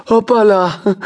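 high bright "Hoppala" as he trips; a goofy stumbling exclamation; clumsy boy voice. Acted. Eddie stumbles over threshold.

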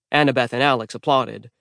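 usual speaking voice

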